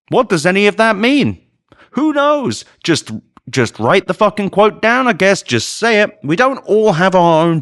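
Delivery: high-pitched exasperated rant. Real, not acted. Rant at an uncritical news report.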